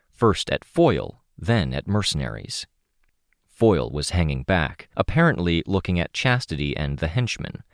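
usual speaking voice

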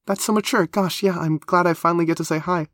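high, gushing and excited; thrilled, high-pitched character voice; sheer excitement. A narrator's reading. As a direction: bubble over with delight at finally meeting. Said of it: "still a bit out of breath from sheer excitement".

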